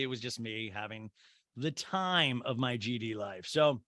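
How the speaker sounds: ordinary delivery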